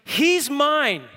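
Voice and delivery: loud, high and proud, slowing on my son; boisterous affirmation. Retold or imitated. Preacher voicing God claiming his son, booming and proud. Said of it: "It's a boisterous word of affirmation".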